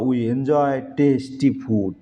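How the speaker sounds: very slow, careful reading; slow dictation. Shown as a demonstration. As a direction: read the sentence slowly for the class. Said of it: "reads sentence slowly". (ধীরে বাক্য পড়া)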